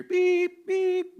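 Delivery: high, piercing mock backing-up beep; truck reversing beep. To the crowd, mimicking a reversing truck's beep. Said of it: "like one of those big trucks you hear".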